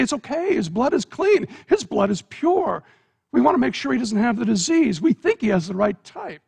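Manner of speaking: high, quick, eager hospital voice; excited reassurance. Acted.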